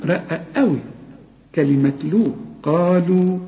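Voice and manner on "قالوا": ordinary talk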